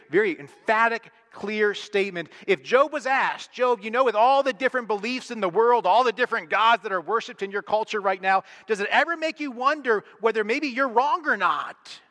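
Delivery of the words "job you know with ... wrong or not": high questioning voice. Retold or imitated. Skeptic questioning Job.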